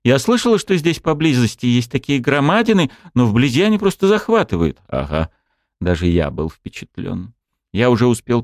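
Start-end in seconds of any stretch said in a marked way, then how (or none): 0.0-4.8 put-on young woman voice. A narrator's reading. Wolf girl voiced, awed.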